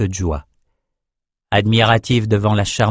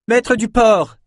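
high-pitched hail; calling out; 13 semitones higher, slower than usual. A narrator's reading. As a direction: call across to someone on deck. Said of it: "cria Nietzsche en approchant". (cri pour héler quelqu'un)

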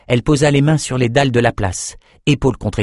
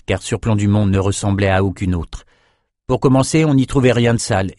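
ordinary delivery

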